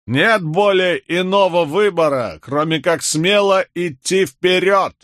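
high, slow oratorical call; shouted address. A narrator's reading. Rebel leader turning to address his men, shouting. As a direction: call out to a crowd, high and drawn out. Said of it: "крикнул".

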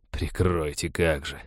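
low, quiet sceptical grumble; soft, low, sarcastic muttering; grumbling. A narrator's reading. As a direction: grumble it softly to yourself, disbelieving. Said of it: "проворчал пират".

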